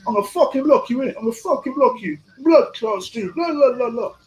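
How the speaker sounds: high-pitched mock Jamaican tirade; hammed-up patois rant; angry Jamaican impression. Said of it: "when he gets angry and he puts on the Jamaican thing".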